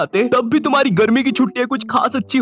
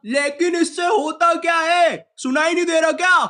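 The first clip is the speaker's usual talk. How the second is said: exasperated repeat; 7 semitones higher than usual. Acted. Repeats for one who didn't hear.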